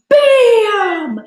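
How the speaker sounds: high loud bam; big bam. Shown as a demonstration. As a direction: slam it out. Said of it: "make a big bam sound".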